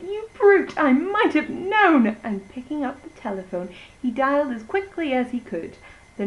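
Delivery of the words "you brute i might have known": much higher, louder outraged squeal; squealing pig. Acted. The third pig crying out at the wolf, high and loud. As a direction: cry it high like a frightened piglet. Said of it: "Pig cried".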